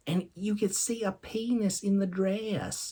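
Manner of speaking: Southern accent, gently voiced; Southern grandma accent. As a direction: lightly imitate a Southern grandmother. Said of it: "animate my voice a little bit".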